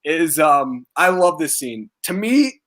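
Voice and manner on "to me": real crack as pitch jumps up. Real, not acted. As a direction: voice breaks high at the phrase's end. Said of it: "my voice is, like, cracking".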